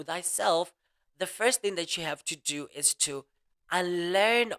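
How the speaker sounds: slow, measured delivery; deliberately slowed down. Real, not acted. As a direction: space the phrases out with pauses. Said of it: "Let me slow down".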